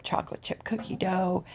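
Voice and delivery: unvoiced whisper on "chocolate chip cookie dough". Real, not acted. Whispered repeat.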